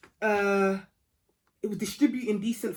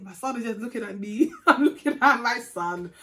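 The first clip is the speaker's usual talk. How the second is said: laughing while talking; 2 semitones higher, 4 dB louder than usual. Real, not acted. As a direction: laugh through it, it's embarrassing. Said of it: "I'm laughing".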